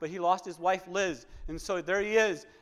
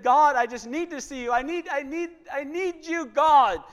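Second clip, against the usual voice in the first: higher, pleading cry to God; retold desperate plea, pitched up and aching; Virgil's pleading prayer; 6 semitones higher, 4 dB louder than usual. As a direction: beg like a broken man reaching for God. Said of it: "He said".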